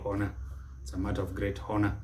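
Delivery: honor with the h wrongly sounded; mispronunciation. Shown as a demonstration. Teacher warns against sounding the H, mispronounced honor. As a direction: say honor with a breathed h at the front, softly. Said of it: "Don't mispronounce it".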